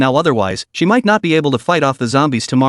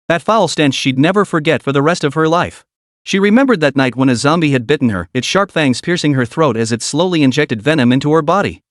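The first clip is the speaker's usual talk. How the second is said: usual speaking voice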